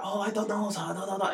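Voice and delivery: caricatured Chinese English. Shown as a demonstration. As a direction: mimic a comic's crude Chinese accent. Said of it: "do an accent of a Chinese person".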